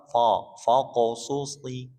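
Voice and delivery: slow "fo, fo khususi" with a round heavy o; wrong thick fo. Shown as a demonstration. Teacher showing students the thick reading to avoid, heavy fo. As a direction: demo the forbidden thick fo. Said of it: "jangan dibaca fo".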